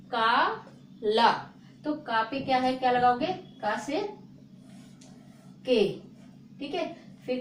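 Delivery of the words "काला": काला drawn out very slowly, syllable by syllable; slow demo. Shown as a demonstration. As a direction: stretch काला out slowly like a teacher. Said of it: "क्या लिखा है".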